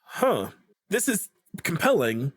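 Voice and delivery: ordinary talk, nothing marked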